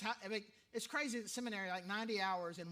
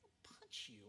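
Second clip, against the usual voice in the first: whispered joke; 11 semitones higher, 12 dB quieter than usual. Pretend. Joking threat to a cliché.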